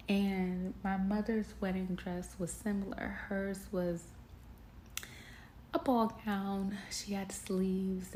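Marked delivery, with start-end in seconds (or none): none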